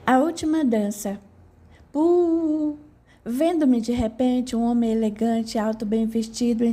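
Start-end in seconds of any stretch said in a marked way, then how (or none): 1.9-2.8 high puff of sudden appearance; puff sound. Acted. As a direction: pop into the room with a puff. (sopro agudo de aparição)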